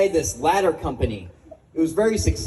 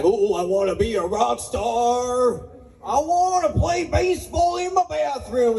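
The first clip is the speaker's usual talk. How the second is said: high, slow mock rock-star croon; 5 semitones higher, slower than usual. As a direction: ham up a whiny rock singer. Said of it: "Or like Nickelback".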